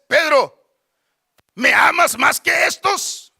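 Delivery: firm, slow, insistent question; Jesus. Acted. Lord asking a third time, loud insistent question. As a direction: call the name, then press hard with the question. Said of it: "Y le dice la tercera vez".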